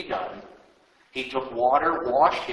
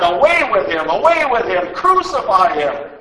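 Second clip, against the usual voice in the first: shouting; 5 semitones higher, 10 dB louder than usual. Acted. Crowd rejecting their king, loud shouts. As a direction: drive it home louder each time. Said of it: "They shouted".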